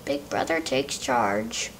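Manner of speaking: a deep knowing comment; low aside. Real, not acted.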